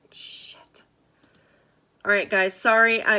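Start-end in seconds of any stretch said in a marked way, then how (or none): 0.1-0.8 under-breath whispered swear; whispered curse. Real, not acted. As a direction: hiss it to yourself. Muttered curse at failure.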